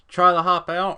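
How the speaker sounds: can't believe you'd try it; incredulous. Acted. Narrator scoffing at player's plan to jump.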